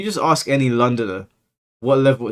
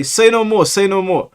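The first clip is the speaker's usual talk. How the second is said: mimicking Londoner; 8 semitones higher, 6 dB louder than usual. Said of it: "And then they'll say".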